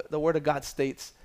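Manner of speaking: normal talking voice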